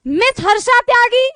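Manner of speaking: the mic announces the sir as a Miss; mock announcer. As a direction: stretch the name out over the mic. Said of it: "mic से आवाज आई".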